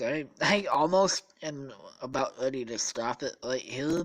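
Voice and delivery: ordinary delivery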